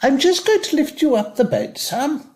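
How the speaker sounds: mock the brisk care-home handling voice; carer's voice. Resident mimicking a carer lifting him in bed. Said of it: "you get touched all the time in here".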